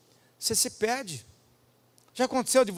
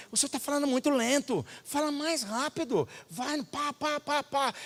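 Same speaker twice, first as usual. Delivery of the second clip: higher, hurried prodding of the translator; urging impatiently; 7 semitones higher, 6 dB quieter than usual. Retold or imitated. Pastor retells nagging his translator to go faster. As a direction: push him to speed up.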